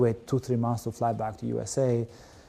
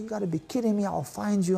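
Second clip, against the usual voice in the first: retold outrage, pitched higher; hammed-up threat, voice raised in pitch; mock furious rant; 8 semitones higher than usual. Calling the bank, mock furious at the clerk. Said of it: "I went Albanian on the guy too".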